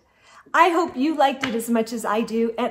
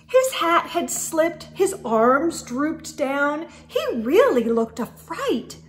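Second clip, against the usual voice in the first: put-on lively read-aloud to children; storybook narration. Reading a snowman story to children, lively narration. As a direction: tell it like a bedtime picture book. Reads picture book aloud.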